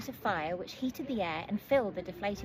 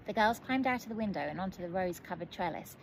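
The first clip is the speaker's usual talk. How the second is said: ordinary delivery, nothing marked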